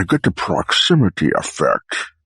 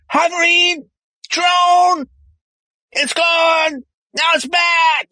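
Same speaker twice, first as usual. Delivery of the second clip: very high, exclaimed bursts; high calling-out; much slower than usual. Acted. Man at the monitor reports the drone, high excited calls. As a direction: call out each update high and separately.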